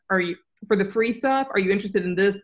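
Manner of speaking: normal talking voice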